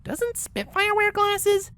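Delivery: usual speaking voice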